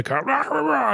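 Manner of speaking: high garbled mock yell; imitated comic yelling. Co-host imitating the comedian's yelling shtick, high mock yell. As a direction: mimic the comedian's yelling gibberish. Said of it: "yelling thing".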